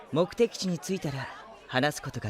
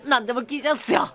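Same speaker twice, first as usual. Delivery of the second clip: eager agreement; 12 semitones higher, 4 dB louder than usual. Acted. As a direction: jump at the offer. Eager crewman agrees to anything.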